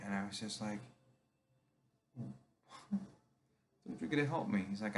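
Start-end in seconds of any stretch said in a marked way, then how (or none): 2.2-4.7 mild retold plea. Patient begging his therapist not to end the session. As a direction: protest like a hurt kid being sent away.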